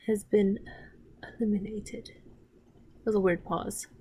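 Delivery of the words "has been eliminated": whisper. Retold or imitated. Mom's menacing line in the show.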